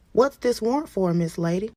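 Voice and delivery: high, loud officer voice; inquisitive officer. Acted. Officer to arrested woman about warrant, inquisitive.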